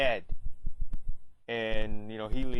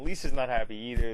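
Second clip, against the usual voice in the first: ordinary talk, nothing marked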